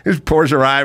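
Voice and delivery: ordinary delivery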